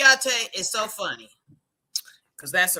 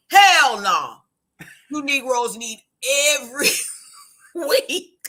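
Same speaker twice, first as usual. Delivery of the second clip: loud, higher mock-stern no; father's blunt refusal; 7 semitones higher, 9 dB louder than usual. Father telling recruits they meet weekly, loud. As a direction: bark it like a tough dad. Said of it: "my father started a boot camp".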